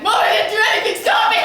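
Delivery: high, loud panicked protest; kid's shrill denial. Retold or imitated. Child yelling at mom that nothing happened, loud and high. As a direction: yell it like a guilty kid caught out. Kid denying to mom, shouting.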